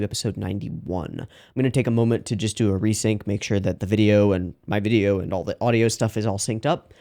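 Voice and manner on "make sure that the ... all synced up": ordinary talk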